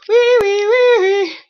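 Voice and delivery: high, loud squealing wee wee wee; sharp mocking squeak. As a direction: squeal it like a piglet to mock the word. Mocking the repeated "we.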